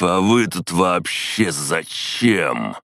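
slow, every syllable separated; careful drunk enunciation; carefully enunciated. A narrator's reading. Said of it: "Тщательно выговаривая каждый слог".

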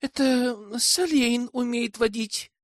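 high, slow, coquettish lilt; teasing girlish voice with a smile; playful smile.

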